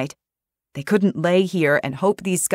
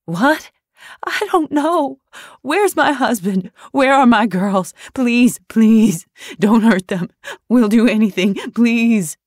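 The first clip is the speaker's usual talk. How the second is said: high, loud panicked begging; terrified pleading; 7 semitones higher, 7 dB louder than usual. Acted. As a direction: beg for your family's lives. Daphne begging for her family.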